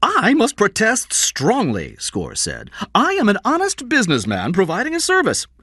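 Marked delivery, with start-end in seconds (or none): 0.0-2.0 high, loud, drawn-out indignation; indignant protest. Acted. Score protests to the policeman ordering him out, indignant. 2.9-5.5 high-pitched wounded insistence; indignant protest. Acted. Score defends himself as an honest businessman, indignant.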